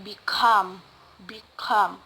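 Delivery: become with COME stressed, said twice; stress on second syllable. Shown as a demonstration. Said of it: "the other example is".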